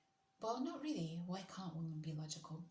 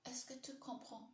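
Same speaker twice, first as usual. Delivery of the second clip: higher-pitched learner's French accent; imperfect French accent; 6 semitones higher, 4 dB quieter than usual. Shown as a demonstration. Streamer testing a French phrase for viewers, imperfect French accent. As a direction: have a careful go at a French accent. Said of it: "this accent's all wrong".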